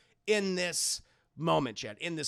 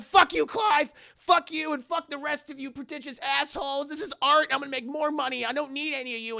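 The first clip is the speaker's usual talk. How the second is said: high, raised-voice mock drunken tirade; retold angry outburst; 8 semitones higher than usual. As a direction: lash out as an imitated angry drunk. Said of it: "He's definitely an angry drunk".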